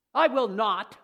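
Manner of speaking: loud, offended protest; indignant denial. Retold or imitated. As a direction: shout it back in outrage. Peter denies he'll deny.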